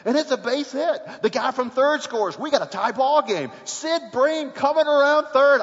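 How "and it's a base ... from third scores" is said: ordinary talk, nothing marked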